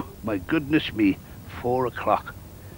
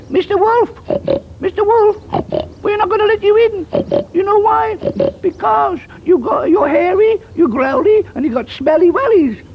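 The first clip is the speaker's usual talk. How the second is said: very high, loud squeaky piggy voices; squeaky piglets; 7 dB louder than usual. Acted. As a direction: squeal it out like cheeky little pigs. Piglets voiced answering the wolf.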